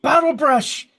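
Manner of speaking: high, loud alarmed call; urgent yell. Acted. Gnome warns Bottle Brush of steam, urgent high yell. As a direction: shout a high-pitched warning across machinery. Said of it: "you hear somebody else".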